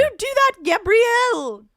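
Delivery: high, loud South African scolding; a South African mum telling Gabrielle off; South African accent. Shown as a demonstration. Asked by co-host for it, loud South African accent. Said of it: "Can you do a South African accent?".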